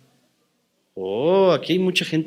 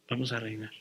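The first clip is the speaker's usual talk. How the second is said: much softer repeat of "Vamos a reinar"; soft echo; 2 semitones lower, 15 dB quieter than usual. Shown as a demonstration. Preacher echoing the promise to the congregation, very softly. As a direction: let "Vamos a reinar" fall away almost inaudibly. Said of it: "repeated line, echoed softly".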